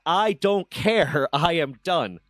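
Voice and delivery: very slow, loud, each word spaced out, ending in a laugh; slow, final dismissal. Retold or imitated. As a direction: drag it out to close the door, then laugh.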